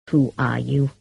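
low, menacing growl. A narrator's reading. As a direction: growl the question at the stranger. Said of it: "he growled".

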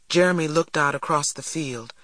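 plain reading voice

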